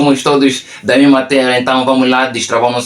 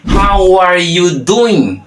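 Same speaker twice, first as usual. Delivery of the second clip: very drawn out and higher; slow demonstration; 5 semitones higher, much slower than usual. Shown as a demonstration. Teacher introduces the second way of asking, slow demonstration. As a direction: stretch every word out for learners.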